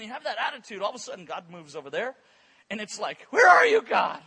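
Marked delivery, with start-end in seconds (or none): 3.3-4.2 loud, high panicked shout; mock freaking out. Preacher acting out believers left behind as God moves, panicked yell. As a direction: cry out as if abandoned, hammed up. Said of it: "You start freaking out".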